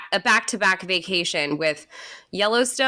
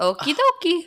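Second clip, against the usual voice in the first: high cartoon Luigi voice; 9 semitones higher than usual. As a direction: chirp it like Luigi. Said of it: "I think he says".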